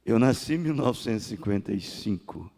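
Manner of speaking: usual speaking voice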